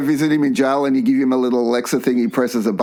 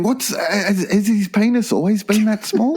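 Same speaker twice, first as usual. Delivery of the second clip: higher mock wife, asking; curious question in a put-on higher voice; new wife's voice; 7 semitones higher than usual. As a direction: play a puzzled new wife, pitched up. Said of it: "or your new wife would be talking to it".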